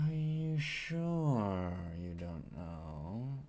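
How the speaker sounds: very slow, stretched-out question; exaggerated disbelief. Pressing a hostile stranger, drawn-out mock disbelief. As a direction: start loud, drag out each word as it drops.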